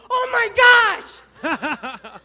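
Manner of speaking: loud, high comic cry of dismay; horrified outburst. Retold or imitated. Rookie turning to senior partner, hammed-up horrified outburst. Tells partner of her blunder.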